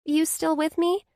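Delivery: high, reassuring friend's voice; best friend, kind and sure; comforting Blair. A narrator's reading. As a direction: ease her friend's guilt. Blair checking on her.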